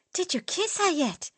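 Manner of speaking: high, prying tease; teasing. Acted. Dieter to Max, prying about his girlfriend. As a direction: needle him for gossip.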